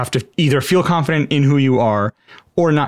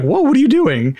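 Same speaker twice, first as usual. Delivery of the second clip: startled whoa; 12 semitones higher, 4 dB louder than usual. Retold or imitated. Bully startled by outburst.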